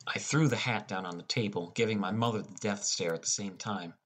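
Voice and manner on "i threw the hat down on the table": ordinary delivery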